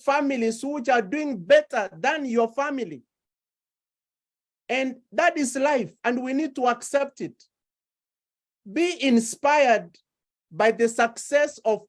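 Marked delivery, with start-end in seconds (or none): none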